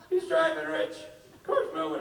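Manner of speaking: high, loud mock panic; panicked Rob. Friend narrating the cab to Rich, high and frantic. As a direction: shriek it like a lost drunk friend.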